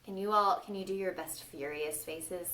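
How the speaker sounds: plain reading voice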